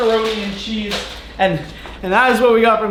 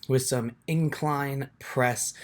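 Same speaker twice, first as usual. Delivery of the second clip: much softer, low-key recorded voiceover; voiceover narration; 7 semitones lower, 8 dB quieter than usual. Real, not acted. Vlogger narrates his chest workout to viewers in a soft voiceover. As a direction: talk over the footage, calm and close to the mic. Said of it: "tips on a voiceover".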